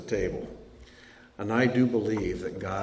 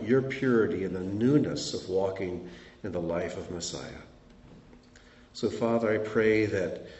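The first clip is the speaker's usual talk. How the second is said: prayer; 3 semitones lower, 3 dB quieter than usual. Acted. Closing prayer over congregation.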